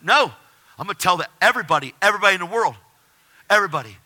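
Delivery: loud, high, emphatic refusal; defiant proclamation. Retold or imitated. Refusing to keep it to himself, defiant. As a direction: declare it to the whole world.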